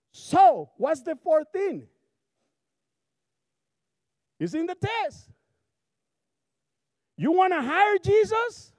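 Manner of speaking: high, loud, driving preacher voice; preaching at full force. Acted. Pastor challenging the congregation, full-force preaching. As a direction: hammer the gospel home.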